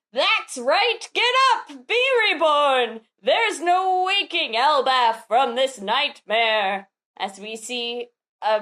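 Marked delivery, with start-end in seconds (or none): 0.1-6.9 high, hammed-up exultant command; gloating villain. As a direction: command the dead to rise, theatrically. Villain rallying demons in manga.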